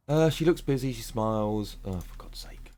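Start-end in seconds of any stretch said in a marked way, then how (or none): 1.8-2.7 breathy under-the-breath grumble; whispered exasperation. Real, not acted. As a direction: whisper it, fed up.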